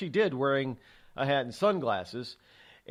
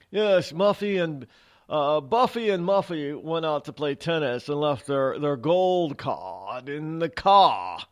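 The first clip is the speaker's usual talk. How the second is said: hammed-up country-club snob, drawn out; mock the wealthy tennis set, slowing on the last words; 2 semitones higher, 4 dB louder, slower than usual. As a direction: play a slow, affected country-club type. Mocking country-club tennis couple.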